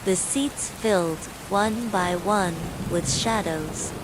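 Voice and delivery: ordinary delivery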